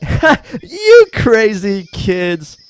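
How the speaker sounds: dad to kids, hammed-up slow chuckle line; mock dopey dad. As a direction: drawl it fondly like a goofy dad. Voicing dad in sketch.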